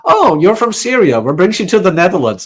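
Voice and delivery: bright, loud oblivious question; loud, cheerful past self asking the Syrian girl where she's from; clueless cheery self. Retold or imitated. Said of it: "my dumb ass goes".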